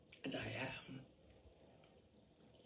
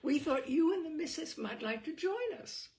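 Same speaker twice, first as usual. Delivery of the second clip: gracious invitation; 8 semitones higher, 6 dB louder than usual. Pretend. Imagined hostess invitation.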